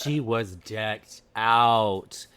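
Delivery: drawn out slowly; slow emphatic. Real, not acted. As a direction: stretch each word out.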